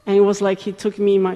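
ordinary talk, nothing marked

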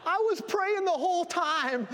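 very high, loud Southern American cry; thick Southern American accent. Retold or imitated. Lady revealing she prayed throughout, thick Southern American accent. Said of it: "in this thick Southern American accent".

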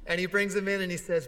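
usual speaking voice

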